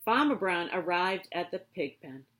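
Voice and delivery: ordinary delivery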